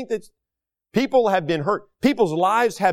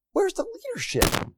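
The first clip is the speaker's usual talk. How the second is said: much higher, exasperated cry of where's; incredulous repeat; 13 semitones higher, 6 dB quieter than usual. Real, not acted. Preacher demanding leadership from colleges, in a high incredulous cry. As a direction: throw the question up in disbelief. Asks the question, then repeats it.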